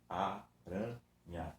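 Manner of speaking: "Aranha" slowly split as a, ra, nha; syllable by syllable. Shown as a demonstration. Teacher showing the repeated vowel A, "Aranha" in separate syllables. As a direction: pull "Aranha" apart with a pause after each syllable. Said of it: "a vogal A que se repete".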